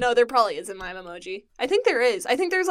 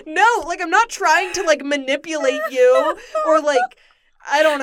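flustered; 4 semitones higher, 7 dB louder than usual. Retold or imitated. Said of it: "I was like".

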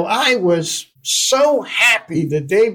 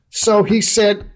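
ordinary talk

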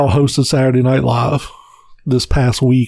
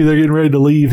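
ordinary delivery

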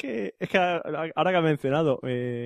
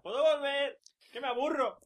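high, soft, faraway mock whine; bored whining; 10 semitones higher, 8 dB quieter, slower than usual. Banished co-host begging to come back, mock bored whine. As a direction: plead like a bored child.

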